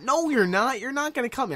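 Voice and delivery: alarmed retold protest. Friend refusing a kid's knife threat, alarmed protest.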